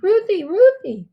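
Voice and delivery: put-on high, loud, drawn-out kids' clamor; demanding kids. A narrator's reading. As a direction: call out insistently like excited children. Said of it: "they demand".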